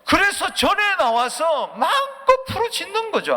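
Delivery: high, slow preacher's shout; loud, stretched exhortation; full-force preaching. Real, not acted. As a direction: cry it out across the church.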